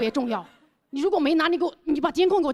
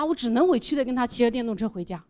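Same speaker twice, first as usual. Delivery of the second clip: normal talking voice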